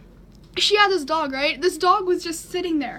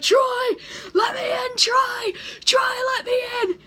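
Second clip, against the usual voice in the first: much higher, crying yell; desperate shout; 9 semitones higher than usual. Retold or imitated. Teen banging on neighbor's door, shouting desperately. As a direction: scream it through tears at the door.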